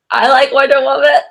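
mock your own flustered, too-loud reply; awkward self-imitation. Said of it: "don't know how to handle those situations".

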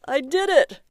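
high, loud cry of triumph; husband cheering at the computer in the morning; joyful scream. Acted. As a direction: shout it out in victory. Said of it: "Barry screamed".

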